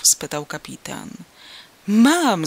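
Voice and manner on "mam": higher and louder, a happy shout; joyful cry. Acted. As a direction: blurt it out with delight. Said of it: "zawołałem radośnie".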